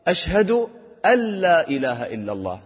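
"أن لا" merged into a long "ألّا"; correct merging. Shown as a demonstration. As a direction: drop the n of "أن" into the l of "لا". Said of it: "هذا إدغام".

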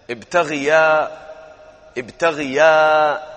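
teacher modelling ابتغيا slowly; slow demo. Shown as a demonstration. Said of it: "إذا بنحط ألف".

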